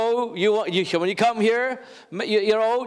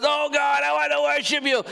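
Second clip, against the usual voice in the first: raised, higher gush of praise; 6 semitones higher than usual. Retold or imitated. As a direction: ham up a worshipper raising their voice. Imitating loud worshippers.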